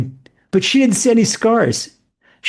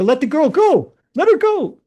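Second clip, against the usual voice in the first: high, urgent cry on let her go; retold shout; 7 semitones higher than usual. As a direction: shout him off the girl, much higher.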